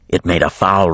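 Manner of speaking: ordinary delivery, nothing marked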